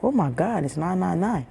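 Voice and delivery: ordinary talk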